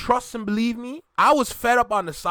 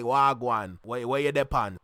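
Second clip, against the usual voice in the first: low Jamaican patois greeting; 7 semitones lower than usual. Shown as a demonstration. Showing listeners how Jamaicans chat, Jamaican patois. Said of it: "when you hear me chat like".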